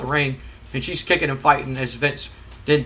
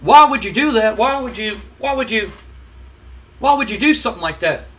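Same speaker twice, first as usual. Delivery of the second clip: imitating Jim Ross; 5 semitones higher than usual. Jim Ross interviewing Triple H.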